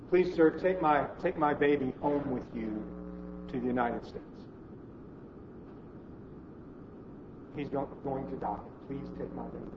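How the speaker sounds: put-on a mother quietly begging for her child's life; pleading mother. Mother begs the visitor to take her starving baby, softly pleading. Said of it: "beg and plead with him".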